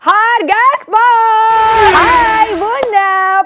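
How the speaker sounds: drawn-out high cheerful call; excited. Real, not acted. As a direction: sing out the greeting to viewers. Host opens live sales show.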